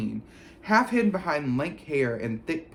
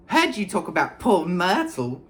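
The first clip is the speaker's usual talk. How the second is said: impish voice, higher and louder; mischievous poltergeist; 6 semitones higher, 5 dB louder than usual. A narrator's reading. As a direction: tease like a gleeful imp. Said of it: "said Peeves, his eyes dancing".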